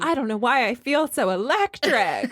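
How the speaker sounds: high, laughing lovestruck gush; mock swooning Susan. Mocking the wife's crush on him, high laughing gush. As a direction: gush like she is melting over him. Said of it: "she was like".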